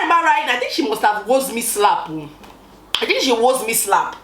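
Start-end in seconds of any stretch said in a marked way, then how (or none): none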